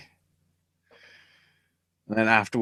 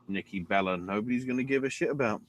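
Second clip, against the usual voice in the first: normal talking voice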